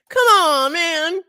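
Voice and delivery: high, loud, drawn-out wail; hammed-up protest. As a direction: wail it at the broken stream. Exaggerated protest at streaming failure.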